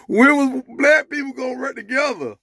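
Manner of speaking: high, loud delivery of the punchline; emphatic repeat. Real, not acted. Joke teller repeating the punchline after laughing, high and emphatic. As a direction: hit the question high and emphatic.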